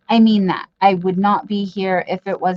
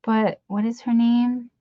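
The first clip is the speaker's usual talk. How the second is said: ordinary talk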